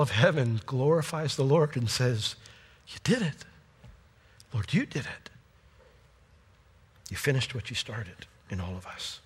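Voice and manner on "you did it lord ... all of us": soft praise. Pretend. Heaven glorifying the Lord.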